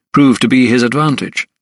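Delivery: ordinary delivery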